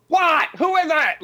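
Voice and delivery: high-pitched impression of a comic yell; Tom Hanks shout. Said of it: "in the Tom Hanks way, he just shouts".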